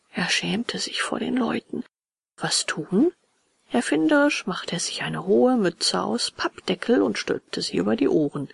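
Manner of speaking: plain reading voice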